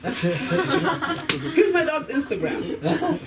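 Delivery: high-pitched mock dog-owner boast; pushy dog owner. Dog owner showing a stranger the pet's account, pushy mock voice. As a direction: show off the pet, hammed-up and high. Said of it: "every time I meet a dog owner".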